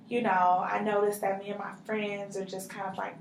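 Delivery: usual speaking voice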